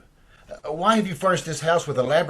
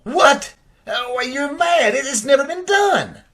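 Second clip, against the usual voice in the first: shocked outburst; 7 semitones higher than usual. Acted. Richard hearing of the brain transfer, shocked shouting. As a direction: blurt it out in disbelief.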